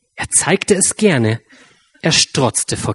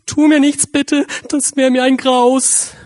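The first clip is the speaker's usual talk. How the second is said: high, louder frightened plea; whimpering lion; 12 semitones higher, 3 dB louder than usual. A narrator's reading. Scared lion begging the tiny mouse: whimpering plea. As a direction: beg for mercy, whimpering. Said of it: "wimmernd wich er erschrocken zurück".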